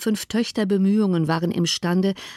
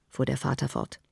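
ordinary delivery, nothing marked

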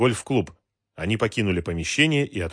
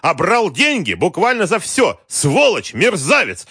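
high, loud raging tirade; furious yelling; 11 semitones higher, 8 dB louder than usual. A narrator's reading. Wolf stamping his feet at Galkin, yelling. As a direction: bellow insults in a fury, voice pushed high. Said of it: "орал Вольф".

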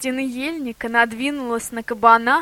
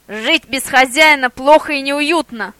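barked; 3 semitones higher, 7 dB louder than usual. A narrator's reading. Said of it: "гавкнул шеф".

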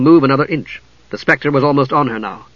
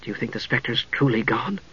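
nervous soft voice; 2 semitones lower, 9 dB quieter than usual. A narrator's reading. Usha fearful at door.

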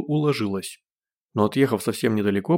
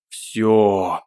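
hysterical howl; 4 dB louder than usual. A narrator's reading. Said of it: "истерически взвыл Гош".

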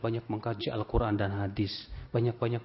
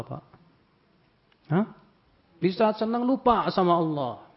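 usual speaking voice

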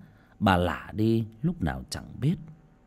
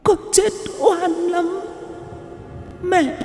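eerie ghost voice; 6 dB louder than usual.

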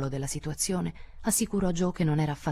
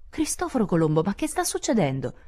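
raised, louder burst of alarm; startled exclamation; 4 semitones higher, 4 dB louder than usual. A narrator's reading. Jo finds Beth red-eyed with camphor in hand, startled exclamation. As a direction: exclaim in sudden astonishment. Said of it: "esclamò Jo".

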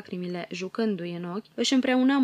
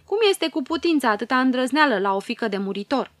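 indignant tiger fairy; 5 semitones higher, 7 dB louder than usual. A narrator's reading. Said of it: "vorbi în ciudată zâna tigrilor".

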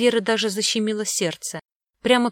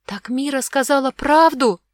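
put-on high, loud girl's outburst; impetuous blurting; 6 semitones higher, 5 dB louder than usual. A narrator's reading. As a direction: blurt it out like an agitated child. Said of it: "выпалила порывисто Огнева".

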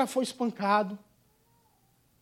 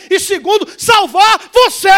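preaching shout; 8 semitones higher, 11 dB louder than usual. Real, not acted. Preacher shouts the climax of the sermon at the congregation. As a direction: drive the point home at full voice.

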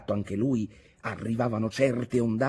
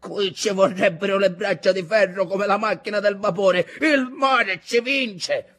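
high, loud outcry; exclaiming, chest heaving; 10 semitones higher, 6 dB louder than usual. A narrator's reading. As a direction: cry out as the sea is winning. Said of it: "esclamò Ntoni col petto ansante". (esclamazione affannata e forte)

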